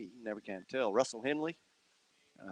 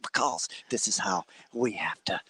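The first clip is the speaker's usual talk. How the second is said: hushed announcer whisper; golf whisper. Pretend. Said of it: "It's kinda like the golf clap".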